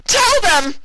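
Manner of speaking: piercing high scream. Acted. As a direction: scream it at her with everything. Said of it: "She screamed at Twilight".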